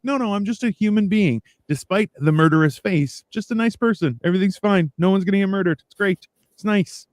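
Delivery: high, harmless nice-person voice; reassuring. Retold or imitated. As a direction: imitate disarming a nervous clerk. Retold reassurance despite murderous face.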